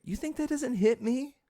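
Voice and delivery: high cracking emotional question. Real, not acted. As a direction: let the voice crack up high on "You think that doesn't hit me?". Repeats louder, voice cracking high.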